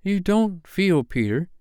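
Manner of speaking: put-on high, hesitant girl's voice; falteringly. A narrator's reading. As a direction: broach it timidly, with a pause. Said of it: "she said falteringly".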